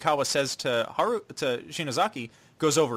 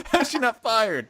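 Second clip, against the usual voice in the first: incredulous; 10 semitones higher, 4 dB louder than usual. Real, not acted. Repeats incredulous reaction.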